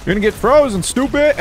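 playground-style jeer; gleeful taunt. Real, not acted. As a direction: taunt the enemies. Taunting frozen enemies.

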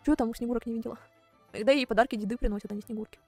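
usual speaking voice

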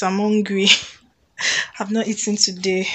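ordinary talk

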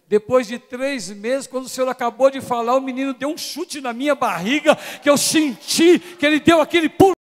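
shouted, high and loud, triumphant; preaching climax. Real, not acted. Preacher to congregation, triumphant high shout. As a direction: drive it home at full voice. Climax retelling the baby's kick.

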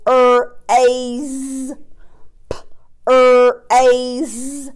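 B-er-ad stretched into separate sounds, slowly. Shown as a demonstration. Teacher sounding out a word for young pupils, stretched. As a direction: sound out B-er-ad segment by segment. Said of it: "what about this one".

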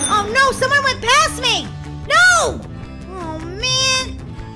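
high, loud cry of dismay; dismayed outcry. Real, not acted. Gamer overtaken in the race, dismayed outcry. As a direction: wail it as a rival overtakes.

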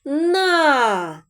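teacher's model syllable; sounding out на. Shown as a demonstration. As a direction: stretch на for the class. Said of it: "І останній склад".

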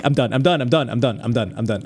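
ordinary talk, nothing marked

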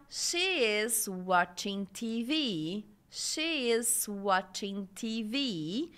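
slow, drawn-out "she is watching T V"; pronunciation model. Shown as a demonstration. Teacher models English for pronunciation practice, slow and drawn out. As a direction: say "she is watching T V" slowly for learners to copy. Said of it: "Vai treinando a pronúncia".

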